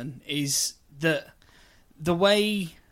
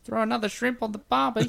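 lightly put-on Aussie accent; a hint of outback Australian; 6 semitones higher than usual. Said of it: "talk it into the mic".

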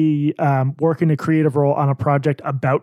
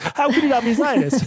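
laughing; 8 semitones higher than usual. Real, not acted. Mocking reversal while laughing.

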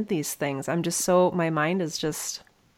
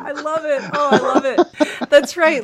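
bright, higher, laughing smile; grinning and giggling as you speak; smiling voice; 7 semitones higher, 6 dB louder than usual. Real, not acted. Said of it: "I am smiling so big right now".

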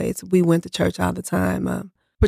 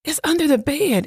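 alarmed insistence; 9 semitones higher than usual. Retold or imitated.

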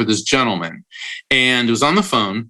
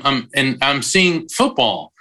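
normal talking voice

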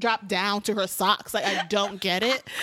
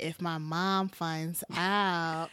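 slow, softer, lightly acted worry; hesitant worry; 4 semitones lower, 5 dB quieter, much slower than usual. Boy warning his girlfriend about his mom, slow and hesitant.

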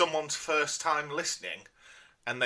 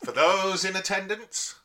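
grand showman announcement; ringmaster. Acted. Grand introduction of the performance.

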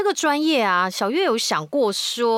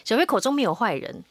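ordinary delivery

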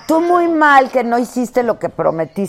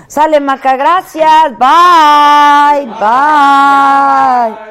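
loud goodbye; 6 semitones higher, 8 dB louder, much slower than usual. Real, not acted.

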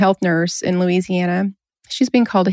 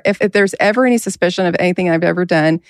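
normal talking voice

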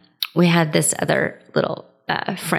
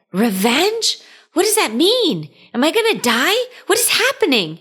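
imitating a panicked kid, pitch shooting up; high, alarmed mock-horrified questions; 9 semitones higher than usual. Little boy asking an adult if he will die, horrified.

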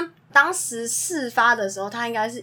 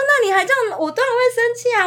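higher and louder, a teacher's exasperated scold; half-playful replay of scolding the boy; mock scolding; 12 semitones higher, 3 dB louder, faster than usual. Teacher reenacting scolding the boy who hit classmates, raised exasperated voice.

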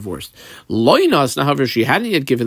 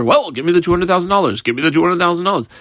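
fast, higher-pitched nagging demand; mock pushy creditor, quick and repetitive; hocking and hassling; 4 semitones higher, faster than usual. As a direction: pester them for payment, rapid and insistent. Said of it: "he's gonna be hocking and hassling her".

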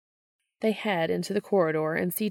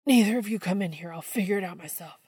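hurried; faster than usual. Acted. Sam panicking in stall.

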